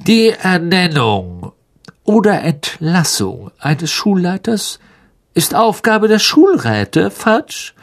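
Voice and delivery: lofty, high-pitched offhand remark; mannered nonchalance; casual. A narrator's reading. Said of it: "sagte Mister Malfoy beiläufig".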